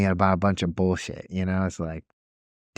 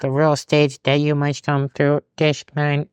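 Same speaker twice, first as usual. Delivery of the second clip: slow, higher mock official; rule-reciting attendant; 6 semitones higher, slower than usual. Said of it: "And it's like".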